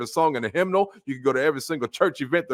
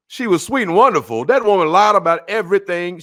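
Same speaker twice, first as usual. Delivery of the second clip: higher and much louder, outraged; indignant shout; 6 semitones higher, 7 dB louder than usual. Real, not acted. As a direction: blast the correction out. Preacher rebutting the eulogy.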